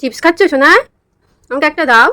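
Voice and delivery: ordinary talk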